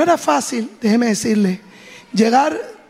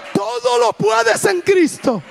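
high, drawn-out rallying cry; impassioned exhortation; 9 semitones higher, slower than usual. Retold or imitated. Mother urges Priscila to walk, impassioned high cry. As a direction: imitate a mother crying out her faith, slow and high.

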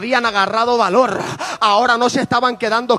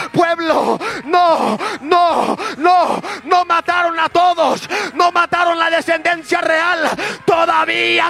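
higher, impassioned preacher's cry; preaching at full force; 7 semitones higher, slower than usual. Acted. Preacher urging the congregation, at full force. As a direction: rouse the congregation like a fiery preacher.

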